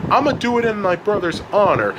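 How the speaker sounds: put-on worked-up teenage anger; mad. A narrator's reading. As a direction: defend your brother heatedly. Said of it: "Elliot gets mad".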